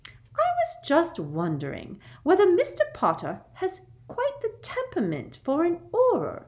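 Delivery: very high, sugary sweet; sickly sweet, high little voice; sweetly. A narrator's reading. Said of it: "said Professor Umbridge sweetly".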